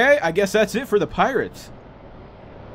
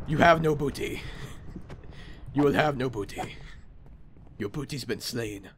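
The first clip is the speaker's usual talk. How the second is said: lightly voiced pirate, trailing softer; half-hearted pirate defending his booty; playful, unconvincing buccaneer; 3 semitones lower, 5 dB quieter than usual. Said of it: "trying to talk in a pirate voice".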